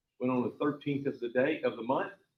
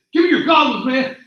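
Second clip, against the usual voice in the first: much higher, louder order; loud demand; 11 semitones higher, 14 dB louder than usual. Retold or imitated. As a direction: bark the order at the cameraman. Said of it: "and he said".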